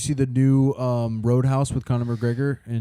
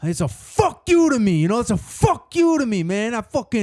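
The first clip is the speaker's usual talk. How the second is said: angry Italian friend; 6 semitones higher than usual. Retold or imitated. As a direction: rant like a fuming Italian-American buddy. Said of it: "when they get mad, they get twice as an Italian".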